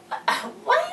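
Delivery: a high, startled what; surprised. Real, not acted. Viewer reacting to the kids' plan, startled. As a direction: caught off guard and squeaking up.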